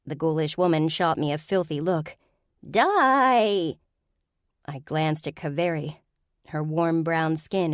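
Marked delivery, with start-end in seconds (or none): 2.7-3.8 ghoul shriek. Acted. Ghoul threatens again.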